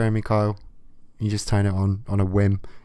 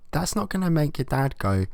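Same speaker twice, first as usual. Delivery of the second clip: usual speaking voice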